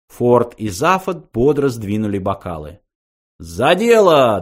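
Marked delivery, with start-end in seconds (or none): 3.4-4.4 slow, loud hearty toast; cheerful toast. A narrator's reading. Ford and Zaphod cheerfully toasting together. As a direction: raise the glass and call it out. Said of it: "дружно воскликнули они".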